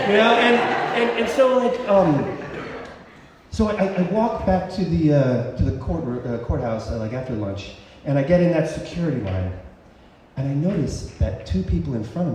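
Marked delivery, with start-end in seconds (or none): none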